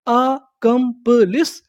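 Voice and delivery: Accomplish split slowly as आ-कम-प-लिस, rising; syllable by syllable. Shown as a demonstration. Teacher asking learners what we call it, slow and rising. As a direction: stretch accomplish out in four slow pieces. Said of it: "उसे हम लोग क्या बोलते हैं".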